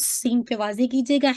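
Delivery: normal talking voice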